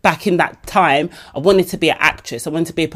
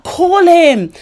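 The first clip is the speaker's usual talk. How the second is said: urging; 11 semitones higher, 4 dB louder than usual. Retold or imitated. Mike urging loudly.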